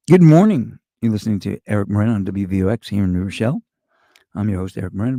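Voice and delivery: loud, fast morning DJ read; radio host voice. Shown as a demonstration. Showing how he opened his radio show, radio host read. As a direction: boom it out like a morning show intro. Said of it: "I was the morning show host".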